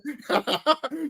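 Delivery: a real, high laugh breaking out; high burst of laughter. Real, not acted. Laughs at the old joke.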